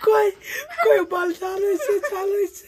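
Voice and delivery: high, slow whiny mock voice. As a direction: mimic her whining in a high voice. Mocking the other's complaint.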